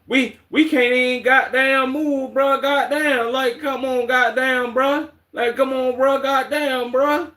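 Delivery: high, slow mock Atlanta drawl of goddamn; mocking Atlanta accent. Streamer mocking how Atlanta people talk, in a high mock Atlanta accent. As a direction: parody an Atlanta guy whining he can't move. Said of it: "Why the fuck do motherfuckers from Atlanta talk like that?".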